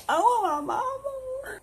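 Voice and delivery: very high, slow, hammed-up crying; mock drunk sobbing. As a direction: wail for mama like a drunk.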